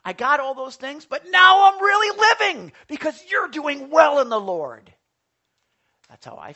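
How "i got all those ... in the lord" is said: higher, louder, building to a full shout; Paul's shouted joy. Acted. Paul crying out to the church about his joy, shouting. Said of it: "And he's saying".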